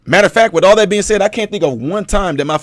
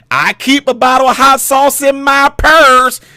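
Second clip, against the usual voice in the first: slow, higher mock of a politician pandering; mocking Hillary; 6 semitones higher, 3 dB louder, slower than usual. Mocking Hillary Clinton's hot sauce line to the crowd. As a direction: drawl it out like a candidate pandering. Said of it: "when Hillary Clinton said that".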